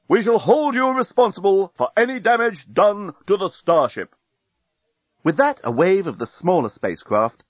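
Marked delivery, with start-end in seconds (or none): 0.1-4.2 high, formal declaration; enemy fleet voice. A narrator's reading. Enemy fleet blaming the starship for any damage, stern. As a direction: pronounce it like an official final warning. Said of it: "they heard the enemy for the last time".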